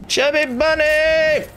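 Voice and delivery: high, drawn-out cheer; excited call-out. Real, not acted. Gamer celebrating a kill in the challenge, high excited call. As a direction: sing out the challenge phrase in triumph.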